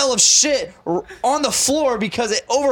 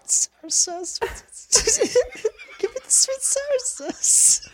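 mock Dane Cook bit; 14 semitones higher than usual. Dane Cook joke imitation.

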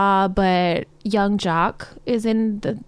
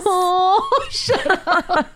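laughing; 9 semitones higher, much slower than usual. Real, not acted. Friend's pet koala joke.

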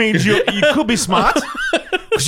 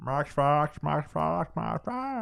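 soft mock-robot chant; badly faked robot voice, very quiet; robotic; 5 semitones lower, 12 dB quieter, much slower than usual. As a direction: repeat it like a robot. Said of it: "trying to be robotic".

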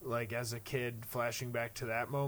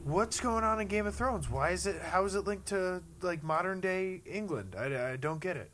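higher-pitched mock confusion; imitated baffled viewers; 6 semitones higher than usual. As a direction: act out a puzzled audience asking questions. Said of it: "people would be like".

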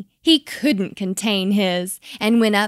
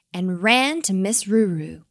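ordinary delivery, nothing marked

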